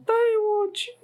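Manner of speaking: very high, slow, crying mock whimper; pitiful sick whine. Sick brother begging to see a doctor, hammed-up crying whine. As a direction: whine like a suffering sick kid. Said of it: "他就说".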